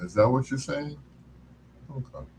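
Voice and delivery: usual speaking voice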